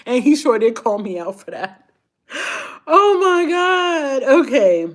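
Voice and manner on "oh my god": laughing oh my god. Real, not acted. Embarrassed after story, laughing.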